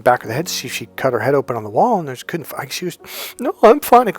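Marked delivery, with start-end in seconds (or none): 3.4-4.1 high, loud mock-tearful denial; imitating a crying girlfriend; imitated tearful girlfriend. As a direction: protest through put-on tears. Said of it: "she instantly started to cry".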